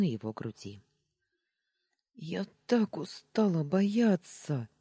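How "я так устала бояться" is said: high, drawn-out tearful wail; plaintive, stretched-out howl. A narrator's reading. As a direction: wail it slowly, worn out by fear. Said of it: "провыла я".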